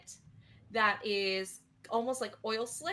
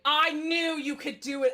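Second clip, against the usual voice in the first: loud, higher burst of encouragement; excited praise; 5 semitones higher, 8 dB louder than usual. Acted. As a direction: cheer the actor on with full volume. Director repeats praise, louder.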